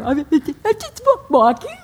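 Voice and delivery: teary. Retold or imitated. Mother answering her son, voice strained with tears. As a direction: mom on the phone, holding back tears, choked up. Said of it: "Are you crying".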